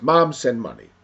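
loud, curt reading of a demand; brusque demand. Acted. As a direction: read it out loudly, dropping at the end. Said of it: "It's how we say things".

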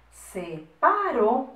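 very slow, stretched SEPARO; slow dictation. Shown as a demonstration. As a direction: draw out each syllable of SEPARO for writing. Dictating words to write down.

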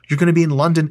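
usual speaking voice

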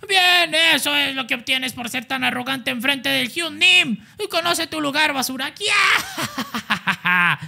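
high, mocking sneer with a laugh; high sneering senior boxer; arrogant senior. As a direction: put the junior in his place.

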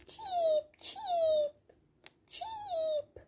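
very high, thin chirping cheep; chick cheeping. Pretend. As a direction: peep like a tiny hungry chick. Voicing the hungry chick.

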